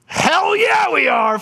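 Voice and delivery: high, loud whoop of joy; cheering shout. Retold or imitated. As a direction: whoop it like an excited teammate. Anesthesia cheers in the OR.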